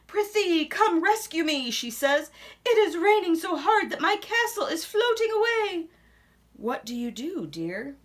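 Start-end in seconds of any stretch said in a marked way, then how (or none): 0.1-1.7 high, loud damsel plea; princess pleading. Acted. Princess calling the knight for help, high and loud. Princess begging from flooding castle. 2.6-5.9 high, loud damsel plea; princess pleading. Acted. Princess calling the knight for help, high and loud.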